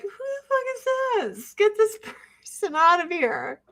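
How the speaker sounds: very high, shrill-sounding put-on outrage; mock annoyed. Blocking the friend's number, very high mock indignation. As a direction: shriek it like a diva.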